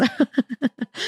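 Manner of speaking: a short, high amused laugh; high little laugh. Real, not acted. Seeing hieroglyph handwriting in meditation, amused high laugh. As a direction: laugh at your own scribbles. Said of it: "So I kinda laughed".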